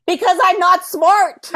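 self-mocking. Self-mocking answer to friend's mom.

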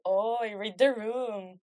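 slow, drawn-out mock exasperation; imagined classmates' groan. Classmates silently reacting to their friend, mock exasperated. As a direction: drag it out as a fed-up class. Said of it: "me imagino tu salón como de".

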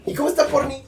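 imitating mom. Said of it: "Y me dice".

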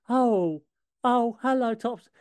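lightly voiced little boy; a hint of a timid boy. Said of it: "Tim is just".

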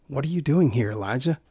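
soft, caring question, trailing quiet on "Elijah"; gentle concern. Acted. God gently asking his child Elijah, soft and caring. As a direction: ask it tenderly like a worried parent. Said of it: "out of gentle concern for his child".

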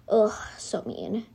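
breathy whisper, a little slow; whispered aside. Acted. Narrator grumbling about mean Allie, whispered. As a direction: hush it to yourself.

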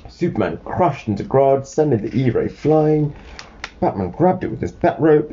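put-on clear, lively read-aloud to a child; storybook narration.

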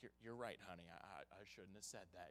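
tender humbled reply; humbled apology. Retold or imitated. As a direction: concede gently to your daughter. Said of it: "I'm like".

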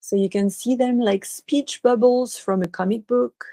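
unhurried meditation guide, long pauses between phrases; guided meditation. Real, not acted. Guiding listeners to relax and imagine, guided meditation. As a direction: lead them gently through it, leaving space after each instruction.

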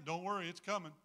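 ordinary talk, nothing marked

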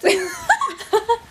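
high burst of laughter. Real, not acted. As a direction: crack up at yourself. Laughing at themselves.

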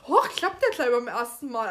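loud mock astonishment; feigned surprise. Host plays Hermione succeeding first time, mock surprised. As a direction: act amazed at your own success.

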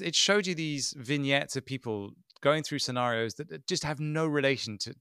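ordinary delivery

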